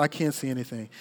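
quiet helpless repeat; hushed despair. Retold or imitated. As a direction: let it fall away into a hush. Repeated, voice dropping low.

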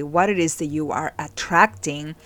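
ordinary delivery

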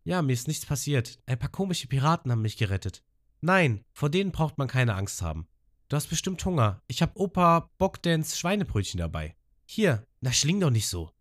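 hohe Mädchenstimme; Abyss spricht. Retold or imitated. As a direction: sanft zu jemandem redend, hohe Stimme. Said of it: "hören Abyss mit jemandem sprechen". (Abyss redet leise mit dem Drachen, hohe Mädchenstimme)

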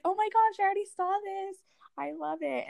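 high-pitched imagined fangirl reaction; high, thrilled squeal of appreciation, acted out; delighted gushing. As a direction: gush as if just shown something adorable. Voicing own imagined delighted reaction.

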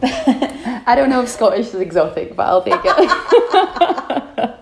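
tertawa sambil bicara; laughing. Real, not acted. Laughing at the accent compliment.

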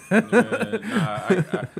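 laughing hard, high and loud; laughing loud through yeah nah. Real, not acted. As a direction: burst out laughing through the words. Laughing at friend's joke.

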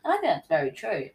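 usual speaking voice

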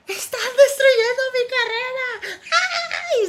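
high hysterical shriek, slow. Retold or imitated. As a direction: shriek it like a meltdown. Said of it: "donde grita".

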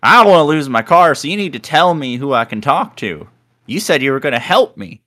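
high, loud, pushy woman caller; demanding caller. Retold or imitated. Wrong-number caller demanding help, high and loud. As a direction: insist like a desperate caller who won't hang up. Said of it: "She's like".

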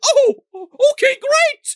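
put-on very high, loud sarcastic old man; mock agreeable dad. Dad would cheerfully accept the bulldozing, mock high squeak. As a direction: chirp it in a mocking cheerful squeak. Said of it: "Dad was gonna be like".

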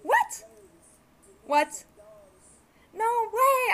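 high, loud stunned "what"; shocked disbelief. Retold or imitated. Retelling her shock at the golf course, high startled squeal. Shocked reaction quoted to friend.

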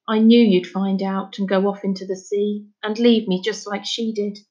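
plain reading voice

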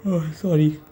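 sleepy yawn. Real, not acted. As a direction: talk through a yawn. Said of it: "Yawning again".

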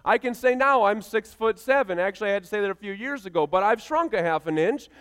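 ordinary talk, nothing marked

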